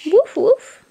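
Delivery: two bright playful barks for the little one; double dog bark. Shown as a demonstration. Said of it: "What does a dog say?".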